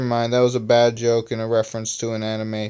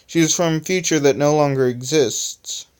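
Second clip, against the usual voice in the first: ordinary delivery, nothing marked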